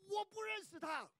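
high, loud denial. Retold or imitated. Preacher acts Peter's third denial, high and loud. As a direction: fling it out. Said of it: "彼得就说".

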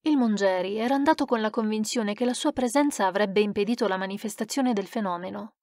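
ordinary delivery, nothing marked

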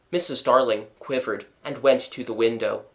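ordinary delivery

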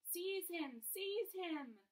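very high, loud shrieking; shrieked. A narrator's reading. Voldemort screams at Quirrell, shrieking. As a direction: shriek the order. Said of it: "shrieked Voldemort again".

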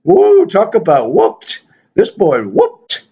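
high put-on puppet voice; a goofy figure introducing himself. The Michael Dodd figure greets viewers in a high puppet voice. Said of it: "There he is".

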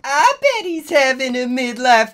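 high, loud put-on character shout. A narrator's reading. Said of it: "Willow shouted".

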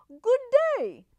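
high, loud, courteous wolf greeting; polite wolf. A narrator's reading. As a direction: sing out a polite hello. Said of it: "politely raising his hat".

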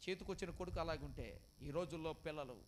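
loud, high, urgent sermon delivery; full-force preaching. Acted. Pastor warning the congregation, loud and high. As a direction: preach it out over the crowd.

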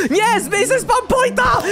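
high, drawn-out order; stretched, high-pitched shout; urgent yell. Real, not acted.

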